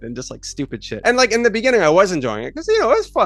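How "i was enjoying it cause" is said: ordinary talk, nothing marked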